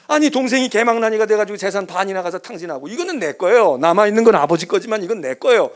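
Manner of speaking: loud, aggrieved protest; resentful complaint. Acted. Elder son protesting to his father, loud and aggrieved. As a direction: throw the grievance at him.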